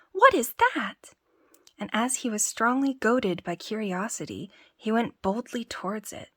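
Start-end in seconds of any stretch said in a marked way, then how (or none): 0.1-1.2 startled, much higher and louder; a sudden astonished shriek; surprised cry. Acted. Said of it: "cried the tailor".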